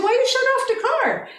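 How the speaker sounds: high-pitched retold scolding; sharp, high question to the son; demanding mother.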